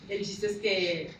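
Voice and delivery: ordinary delivery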